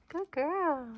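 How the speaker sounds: high sing-song praise for a puppy; dog voice. Real, not acted. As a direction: coo it softly up high. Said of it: "my dog voice".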